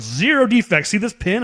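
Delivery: high, loud quoted character; mock movie character. Movie character showing off a pin, mockingly high and loud. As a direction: pitch it up, punching the pin slogan. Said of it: "She's just like".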